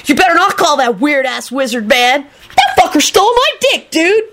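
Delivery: high-pitched indignant rant; angry scream. A narrator's reading. Kenny forbidding Rosie to call the wizard, screeching angrily. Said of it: "Kenny screamed angrily".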